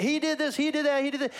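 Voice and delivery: high-pitched, piled-up mock accusations; throwing accusations. Leaders throwing everything at Jesus, mock clamour. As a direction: pelt the accused with charge after charge. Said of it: "they're just throwing everything".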